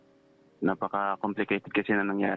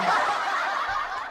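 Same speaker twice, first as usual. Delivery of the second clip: short breathy nervous laugh. Real, not acted. Caller prompted to keep telling the story, nervous breathy laugh. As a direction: chuckle nervously before going on.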